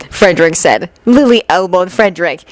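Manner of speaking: plain reading voice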